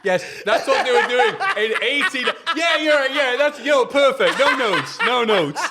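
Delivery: high, loud, words bursting through laughter; real hysterical laughter. Real, not acted. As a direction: shout approval while cracking up. Cracking up at co-host's eighties joke.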